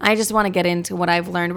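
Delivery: normal talking voice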